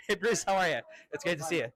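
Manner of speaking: usual speaking voice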